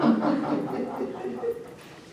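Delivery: amused laughter; real laugh. Real, not acted. Storyteller joking about the hiding husband, real laugh. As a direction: laugh at your own joke.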